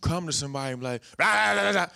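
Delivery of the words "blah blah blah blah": mock rant. Mocking someone who rants at you.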